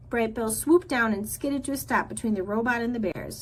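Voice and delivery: ordinary delivery, nothing marked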